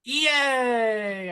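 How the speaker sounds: high, happy yay; excited cheer. Real, not acted. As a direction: cheer like you just won. Celebrating a good roll.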